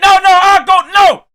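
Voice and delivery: loud, high cutting-in shout; mock shout. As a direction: shout over him to grab the floor. Voicing Akadidi interrupting.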